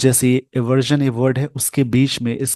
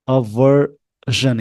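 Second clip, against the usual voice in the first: Aversion slowed right down, middle syllable leaned on; slow teaching demo, stretching each syllable of aversion; stress on the syllable; much slower than usual. Shown as a demonstration. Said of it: "highlighted word पे आपको थोड़ा सा जोर देना पड़ेगा".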